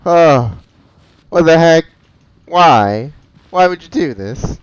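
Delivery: shocked outcry. Real, not acted. Player yelling at the game over a shocking twist, loud dismayed outcry. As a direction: cry out at the screen in real dismay.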